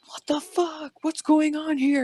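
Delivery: high, drawn-out startled voice; retold alarm. Jumping off shaking bed, retold bewildered alarm.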